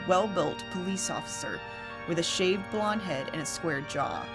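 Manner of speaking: usual speaking voice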